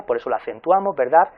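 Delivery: ordinary delivery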